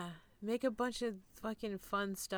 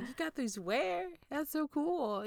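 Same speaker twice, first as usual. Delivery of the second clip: higher, amazed quoting of herself; delighted surprise; 7 semitones higher, slower than usual. Retold or imitated. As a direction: sound amazed at the find. Quoting own delighted reaction.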